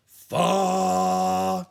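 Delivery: loud growled sung note; big tiger growl on a note. A narrator's reading. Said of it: "The tiger growled".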